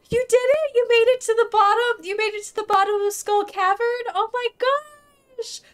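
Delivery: high, thrilled cheering; excited congratulations. Real, not acted. Streamer congratulating a friend on reaching the bottom, excited. Congratulates viewer on beating Skull Cavern.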